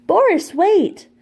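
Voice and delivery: high, loud, slow dainty call from inside; a delicate woman calling out after him. A narrator's reading. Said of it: "a dainty voice called".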